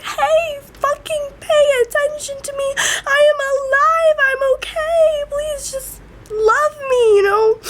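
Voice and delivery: very high tearful wail; crying plea for help. Real, not acted. Teen begging family for attention and love, crying out. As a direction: beg desperately through real sobs. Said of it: "I was screaming for help".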